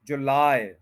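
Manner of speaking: July as joo-LAAY, weight on LAAY; stress on second syllable. Shown as a demonstration. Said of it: "stress जो है वो लाई पे करना है". (जुलाई में "लाए" पर ज़ोर)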